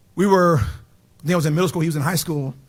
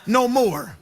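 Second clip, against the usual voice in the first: sermon peak, higher and higher; building preaching climax; 6 semitones higher than usual. Acted. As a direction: climb and call it out to the church. Preaching climax rising high.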